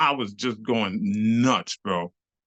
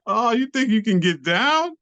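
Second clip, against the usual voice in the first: higher, rising taunt; acted-out dare climbing at the end; mock challenge; 9 semitones higher, 3 dB louder than usual. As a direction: taunt them with an incredulous challenge. Retold taunt from the dancers.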